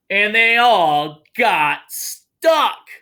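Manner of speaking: slow dramatic read-aloud; dramatic reveal. Acted. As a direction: land the climax slowly. Reads picture book aloud.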